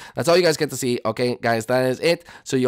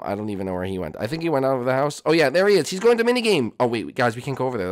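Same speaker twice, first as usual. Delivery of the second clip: normal talking voice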